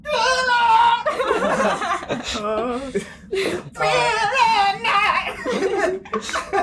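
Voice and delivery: high, loud wailing whale call; distant whale cry. Acted. Player imitating the whale heard way off, high wailing cry. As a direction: wail like a whale far off, very high. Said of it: "you heard way off in the distance, you heard".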